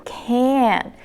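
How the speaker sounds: "Can" held long with a full vowel, no t; stressed "can't". Shown as a demonstration. Teacher isolates the negative form, drawn-out "Can". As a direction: breathe out through a long "Can". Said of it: "That's how it sounds".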